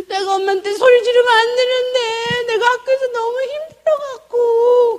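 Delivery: very high, slow tearful whine; mock wailing. Daughter confessing to mom, wailing in imitation. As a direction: bawl it out like a sobbing child. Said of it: "통곡을 해요".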